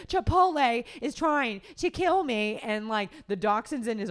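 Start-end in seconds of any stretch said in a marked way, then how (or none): 0.1-2.6 high, loud mock outrage; retold yell, pitched way up; imitated yelling. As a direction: yell it like an aggrieved internet ranter. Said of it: "like, y-, yelling about this".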